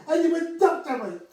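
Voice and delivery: high, loud put-on voice of the chap; imitating his speech. Storyteller copies the chap in the pink shirt, high and loud. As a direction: mimic the odd way he talked. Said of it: "'cause that's the way he spoke".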